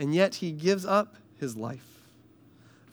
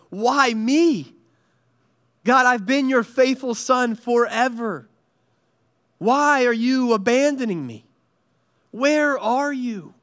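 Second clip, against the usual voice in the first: anguished plea; 7 semitones higher, 4 dB louder than usual. Acted. Preacher voicing Jesus crying out to his Father, anguished and pleading. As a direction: plead with God as a forsaken son. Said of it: "It's like he's just saying".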